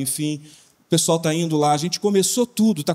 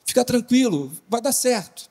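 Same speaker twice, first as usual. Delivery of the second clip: pitched much higher and faster, heated; a heated retort told half-acted, higher and rushed; retold raised voice; 7 semitones higher than usual. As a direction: push the voice up, rattled and quick. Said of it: "eu levantava a voz".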